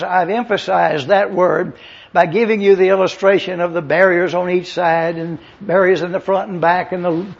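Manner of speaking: usual speaking voice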